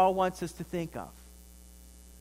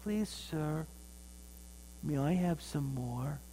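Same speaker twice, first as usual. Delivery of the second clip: put-on a touch of a shy boy, soft; meek small request; soft timid plea; 2 semitones lower, 8 dB quieter, slower than usual. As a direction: ask sheepishly, quietly. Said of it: "And he said".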